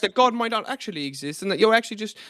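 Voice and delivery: hammed-up British debater with a rolling lilt; put-on posh British voice asking question after question; mock British accent. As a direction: mimic a British philosopher, mockingly. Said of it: "in the British accent".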